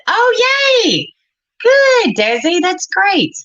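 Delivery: a sudden burst of joy for a viewer; excited cheer. Real, not acted. Thrilled reaction to a viewer.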